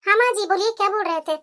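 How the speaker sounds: tired daughter-in-law answering politely. Acted. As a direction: answer your mother-in-law meekly.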